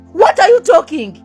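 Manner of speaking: normal talking voice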